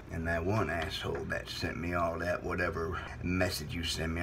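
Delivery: deep, controlled voice; low solemn menace. Acted. Host to friends, then caller, low grave voice. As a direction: keep it low, turning to a threat.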